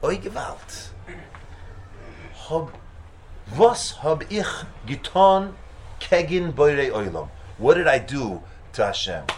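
loud, slow anguished cry in Yiddish; Yiddish lament. Acted.

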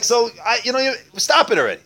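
high, loud, fast plea to stop; jealous exasperation. Acted. Jealous beggar cutting off his friend's Pesach talk, exasperated. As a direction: blurt it out, fed up and envious. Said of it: "I'm getting jealous".

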